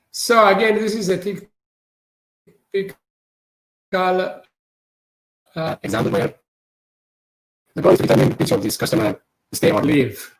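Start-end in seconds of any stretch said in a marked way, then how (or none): 2.7-10.3 garbled audio. Real, not acted. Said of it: "Oh, no".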